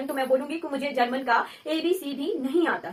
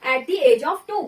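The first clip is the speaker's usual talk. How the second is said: slow and a little louder; slow dictation; 6 dB louder, much slower than usual. Shown as a demonstration. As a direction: dictate slowly for the class. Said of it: "example लेंगे".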